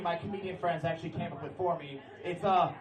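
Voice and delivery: ordinary delivery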